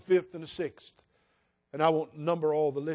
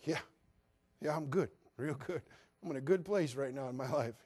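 feigned okay; 7 dB quieter than usual. Retold or imitated. Rejected suitor pretending he's fine.

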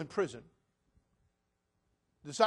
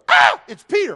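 startled cry; 6 semitones higher, 18 dB louder than usual. Retold or imitated. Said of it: "she goes".

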